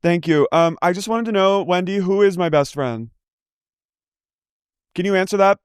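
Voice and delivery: high, chirpy phone voice; sweet business call; mock phone call. Role-playing a call to Wendy.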